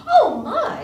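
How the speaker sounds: high, loud startled cry; exclaimed. Acted. Rebecca startled as latkes escape, loud high exclamation. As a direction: gasp it out in alarm. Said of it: "exclaimed Rebecca".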